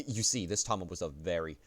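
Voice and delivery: ordinary talk, nothing marked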